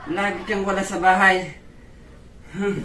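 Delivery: ordinary talk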